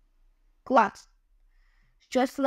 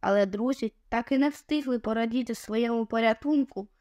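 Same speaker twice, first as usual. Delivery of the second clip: ordinary delivery, nothing marked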